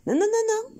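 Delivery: high, quick alarmed stop to the dog. Real, not acted. Owner stopping her dog grabbing both pots, alarmed. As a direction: stop him, high and sharp.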